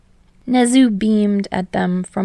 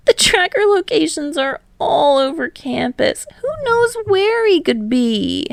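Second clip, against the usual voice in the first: high panicked wail; despairing cry; 9 semitones higher than usual. Acted. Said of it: "cried out in despair".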